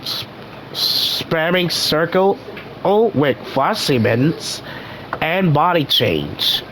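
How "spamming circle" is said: ordinary delivery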